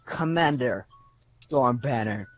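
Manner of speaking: low, quiet, measured correction; stern commander correcting. Acted. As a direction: put him in his place, low and unhurried. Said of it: "and she's like".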